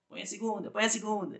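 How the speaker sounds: high, soft pleading engine voice; a playful high little plea from the car; voicing the engine. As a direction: let the motor beg for the gear change. The engine begging for second gear.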